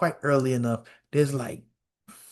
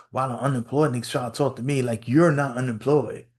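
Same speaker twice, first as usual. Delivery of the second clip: usual speaking voice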